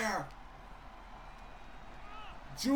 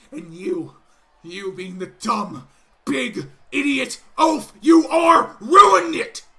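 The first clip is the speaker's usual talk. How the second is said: furious tirade; 12 dB louder than usual. Acted. Gable berating Otis, rising into a furious roar. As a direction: explode at him by the end.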